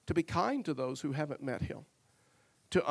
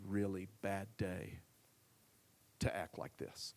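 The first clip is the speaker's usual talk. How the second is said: soft and low, almost hushed, soothing an angry man; gentle calming voice; 7 semitones lower, 5 dB quieter than usual. Retold or imitated. Manager calming a yelling customer at the counter, soft and soothing. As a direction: talk him down quietly and kindly, like a manager defusing a scene.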